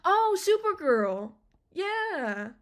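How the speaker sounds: light up at the name; excited. Real, not acted. Fan remembering Supergirl, delighted.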